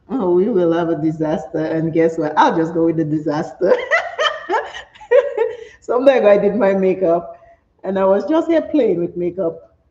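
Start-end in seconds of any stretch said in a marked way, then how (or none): 3.8-5.6 bright high burst of laughter; high laughing burst at own joke. Real, not acted. Laughs at makeup disaster.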